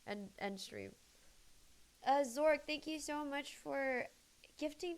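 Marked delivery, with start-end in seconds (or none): none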